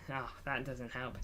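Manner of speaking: ordinary talk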